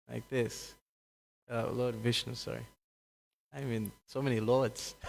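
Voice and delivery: usual speaking voice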